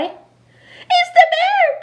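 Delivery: put-on high, loud, slow child's cry of fright; frightened cry. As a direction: squeal it like a scared little boy. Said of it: "cried Eddie".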